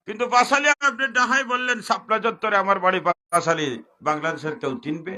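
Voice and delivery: high, loud mocking sermon line; loud preaching. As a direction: hammer the point home to the crowd. Preacher mocks vague address loudly. (উঁচু গলায় ওয়াজ)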